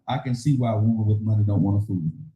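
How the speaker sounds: normal talking voice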